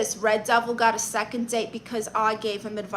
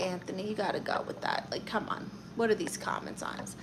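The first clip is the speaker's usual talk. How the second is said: a hushed aside, softer; whispered aside; 3 semitones lower, 11 dB quieter, faster than usual. Real, not acted. Aunt whispering an aside about the comments. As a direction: whisper it under your breath.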